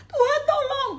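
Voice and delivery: high, loud, sobbing cry for help; desperate tearful shout; crying plea. Acted. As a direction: wail to God for rescue.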